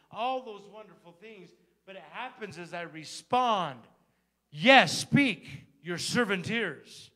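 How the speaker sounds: loud, high, rising sermon delivery; full-force preaching. Acted. Pastor urging his congregation to respond to God, preaching loud. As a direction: preach it hard, calling out to the congregation.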